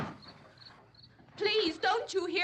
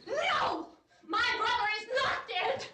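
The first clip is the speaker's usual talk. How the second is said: high shouted no, near tears; frantic denial; 6 semitones higher than usual. Acted. Barbara denying her brother is dead, frantic shout. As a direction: refuse to believe it.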